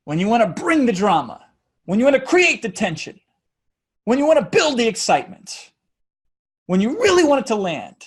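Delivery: a crescendo of parallel phrases; building rhythm. Shown as a demonstration. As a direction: build each line like a rising wave. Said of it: "notice I just spoke in rhythm".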